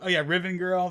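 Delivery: ordinary talk, nothing marked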